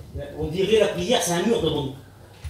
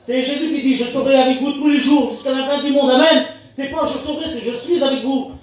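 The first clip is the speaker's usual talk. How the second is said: exhorting preacher; 5 semitones higher than usual. Acted. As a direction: proclaim the promise to the congregation. Preaches God's promise to believers. (prédication exaltée, voix haute)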